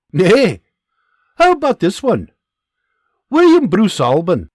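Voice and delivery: loud, high questioning Scottish voice; Scottish sergeant. Acted. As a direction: grill the privates in Scots.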